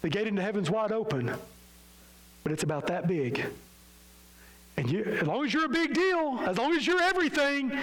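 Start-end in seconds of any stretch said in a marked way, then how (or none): 4.8-7.7 preaching emphasis. Acted. Preacher mocking the proud.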